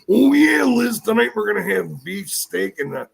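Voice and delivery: prank the wife as Macho Man; hammed-up Macho Man impression; Macho Man prank call. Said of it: "I'd call up and I go".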